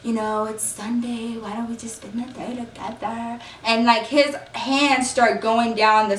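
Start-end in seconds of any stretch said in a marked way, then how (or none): 0.0-3.4 soft whisper. Retold or imitated. Man leaning in at her ear, murmuring softly. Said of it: "whispering in my ear".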